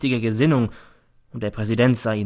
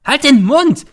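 very high, very loud shriek; a hysterical mother shrieking at her son; 11 dB louder, slower than usual. A narrator's reading. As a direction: screech it at him. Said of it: "kreischt die Mutter".

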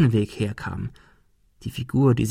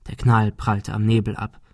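ordinary delivery

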